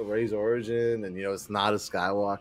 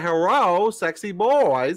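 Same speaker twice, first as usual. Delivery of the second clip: high, slow, hammed-up hello; imitating the chat greeting; 8 semitones higher, 6 dB louder, much slower than usual. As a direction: stretch out the greeting in a silly high voice. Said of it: "He said".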